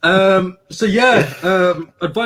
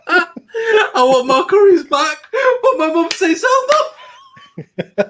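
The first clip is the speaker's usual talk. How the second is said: laughing; 4 dB louder than usual. Real, not acted. Reading a viewer's plea, laughing.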